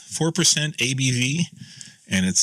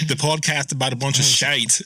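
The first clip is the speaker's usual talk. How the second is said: put-on Australian accent; 6 semitones higher than usual. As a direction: lay the Aussie accent on thick. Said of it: "talk the whole time with this accent".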